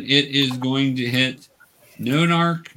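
ordinary talk, nothing marked

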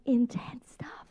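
whisper. Acted. Aside on hard kids' questions.

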